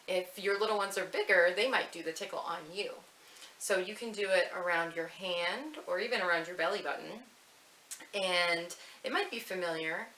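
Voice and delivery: normal talking voice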